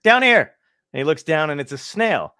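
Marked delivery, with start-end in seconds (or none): 0.0-0.5 higher, louder, slow call from the ground; calling up from below. Retold or imitated. Snail calls up to the man at his door, loud and slow. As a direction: call up to get his attention.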